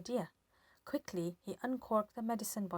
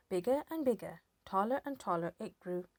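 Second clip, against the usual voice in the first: ordinary delivery, nothing marked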